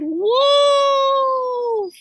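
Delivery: very high, long loud cry; screaming. A narrator's reading. Granny crying for help from the wolf, screaming. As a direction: scream it out at full stretch. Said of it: "Granny screamed at the top of her lungs".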